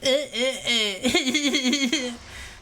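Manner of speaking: long run of high laughter; high giggling fit. Real, not acted. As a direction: keep giggling helplessly. Both cracking up.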